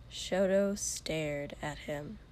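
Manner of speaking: plain reading voice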